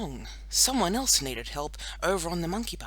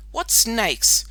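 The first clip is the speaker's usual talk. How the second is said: mildly startled, higher and louder question; puzzled; 6 semitones higher, 7 dB louder than usual. A narrator's reading. Hanging girl asks about imaginary snakes, mildly puzzled. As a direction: ask in some confusion.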